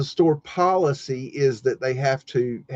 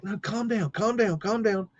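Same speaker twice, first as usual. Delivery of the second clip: higher, softer boss placating her; imitating the boss calming; 7 semitones higher, 6 dB quieter than usual. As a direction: hush her down like a nervous boss. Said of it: "he's like".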